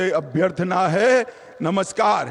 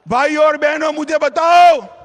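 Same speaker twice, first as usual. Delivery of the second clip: booming political rally speech; 4 semitones higher, 4 dB louder than usual. Real, not acted. Rally call to the crowd.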